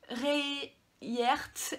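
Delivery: a clumsy French stab at an English accent; "Rayert" said the English way, a bit off; bad English accent. Shown as a demonstration. Naming the manga title to viewers, in a self-mocking English accent. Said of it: "Excusez mon accent pourri anglais".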